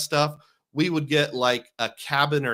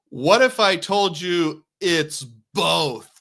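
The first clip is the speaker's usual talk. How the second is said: slow, loud, higher-pitched big reveal; mock-dramatic reveal; 4 semitones higher, 5 dB louder, much slower than usual. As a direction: draw out the big twist for playful suspense. Mock-dramatic reveal before suspense sting.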